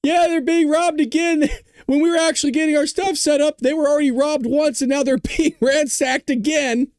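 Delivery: high, hammed-up reporter voice; mocking news reporter. Vlogger mocks a reporter's cheerful report, high hammed-up voice. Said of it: "They're like".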